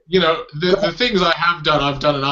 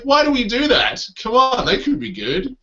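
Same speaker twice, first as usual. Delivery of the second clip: much higher, pleading objection; imitated protest; 11 semitones higher than usual. Comedian questioning fellow Australians' bias, high mock protest. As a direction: retell it as a high-pitched appeal. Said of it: "I'm like".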